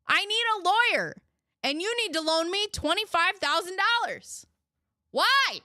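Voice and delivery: high, loud mock plea from the son; pretend son asking for money. Host acting out the son's call for a lawyer, loud and high. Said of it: "wouldn't you ask why".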